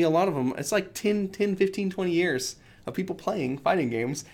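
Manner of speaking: usual speaking voice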